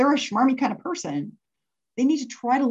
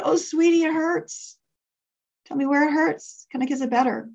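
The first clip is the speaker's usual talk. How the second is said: higher, tender motherly coo; soothing mom; 6 semitones higher than usual. Retold or imitated. Mom to her hurt little girl, soothing. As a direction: comfort a small child in a raised sweet voice.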